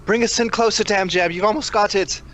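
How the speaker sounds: high-pitched eager calls to the pilot; calling up to Dam Jam in the ship, eager and urgent. Acted. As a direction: urge him closer, excited.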